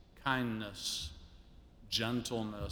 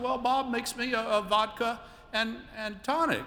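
much higher, genteel lady voice; imitating Gwen; 12 semitones higher, 4 dB louder than usual. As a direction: lift into a refined, high lady voice telling a story. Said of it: "I can't do her elegant accent".

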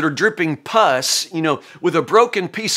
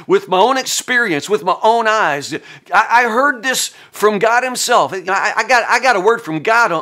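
mock pious boasting; 6 semitones higher, 3 dB louder than usual. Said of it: "speak with such certainty".

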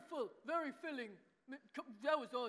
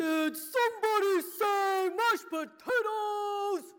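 puppet voice; 6 semitones higher, 9 dB louder, much slower than usual. Acted. Puppet George bursts in.

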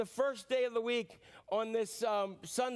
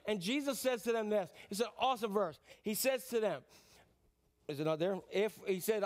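normal talking voice

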